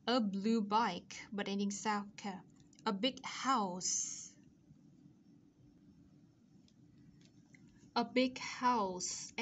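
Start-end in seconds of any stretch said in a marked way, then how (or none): 0.0-1.2 slow, measured model of "A red bike, a blue bike"; careful teacher's demonstration, unhurried; model reading. Shown as a demonstration. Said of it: "đọc các cái cụm từ này". 2.8-9.3 "A big house" slowly, with a clear final s on house; slow teacher's model of "A big house", s ending sounded; careful model pronunciation. Shown as a demonstration. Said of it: "đọc sao cho đúng với cả sao cho chuẩn".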